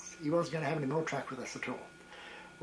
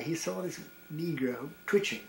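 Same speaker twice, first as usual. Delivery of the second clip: ordinary delivery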